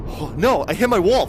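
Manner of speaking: high, loud cry of frustration; dismayed yell. Real, not acted. VR player hitting the wall, dismayed yell. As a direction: yelp it out in real dismay. Bumps real wall while playing.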